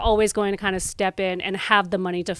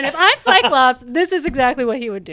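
louder, higher, insistent fan voice; imitating an eager fan; 6 semitones higher, 6 dB louder than usual. Co-host imitates a superfan claiming Cyclops, mock insistence. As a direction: play the know-it-all superfan, laughing through it. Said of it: "And you're like".

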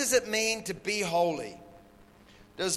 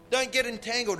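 normal talking voice

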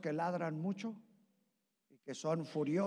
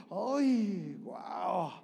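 delighted woman; 4 dB louder than usual. Pretend. Women delighted.

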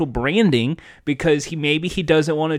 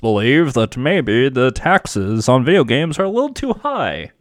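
Markiplier; 2 semitones lower than usual. Acted. President Markiplier addresses the nation on taxes, hammed-up impression. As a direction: play a streamer turned solemn president. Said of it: "Is this your Markiplier?".

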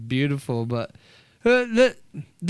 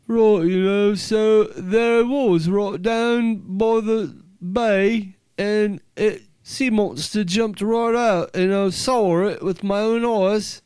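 higher, slow English drawl, kinda Aussie; drawn-out English accent; 5 semitones higher, slower than usual. Shown as a demonstration. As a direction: stretch the vowels in a rustic English accent. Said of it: "they're kinda like Aussie ones, but they're more like a, a drawn kind of".

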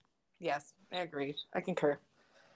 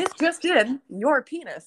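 high, loud mock news anchor; newscaster voice; 8 semitones higher, 7 dB louder, slower than usual. Shown as a demonstration. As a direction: read it like breaking news. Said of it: "do a newscaster voice".

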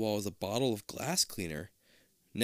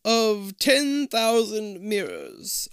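high, slow, eerie disembodied voice; hollow echoing voice; 11 semitones higher, 8 dB louder, much slower than usual. Acted. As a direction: echo it out like a ghost in the walls. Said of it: "a hollow voice echoes".

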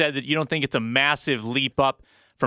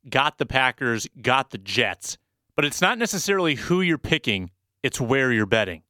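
ordinary talk

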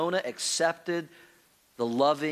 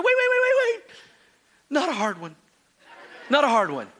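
put-on high, frantic child's wait then begging; hammed-up panic, begging for mercy; frantic plea; 8 semitones higher, 4 dB louder than usual. Son begs before spanking.

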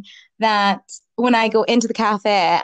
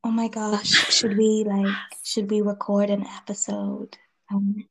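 whispery, softened delivery; hushed ASMR voice; 3 dB quieter than usual. Shown as a demonstration. Podcast host proposing an episode spoken really quietly, hushed. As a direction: drop into a playful near-whisper. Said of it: "speak really quietly".

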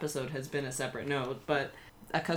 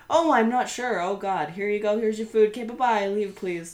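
high, bright-sounding forced politeness a bit louder; fake customer service; 6 semitones higher, 5 dB louder than usual. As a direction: sweetly hurry the annoying customer out the door. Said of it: "But I was like".

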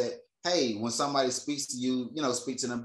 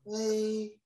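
retold scared child; 7 semitones higher than usual. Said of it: "Like, scared".

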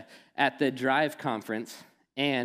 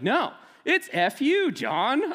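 mock cheeky insistence; 11 semitones higher, 4 dB louder, slower than usual. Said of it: "she's like".

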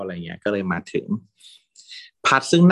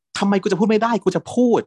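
defiant shout; 11 semitones higher, 3 dB louder than usual. Retold or imitated. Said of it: "พัดก็แบบ".

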